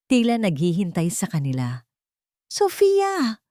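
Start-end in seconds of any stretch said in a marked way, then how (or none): 2.5-3.4 sharp high startled cry of a name; exclaiming. A narrator's reading. Ricardo caught off guard, crying out her name. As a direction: blurt the name out in alarm. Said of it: "Bulalas ni Ricardo".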